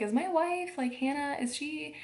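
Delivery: mock-nervous, voice lifting; nervous husband. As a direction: imitate an anxious husband asking after his wife. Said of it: "He got so nervous".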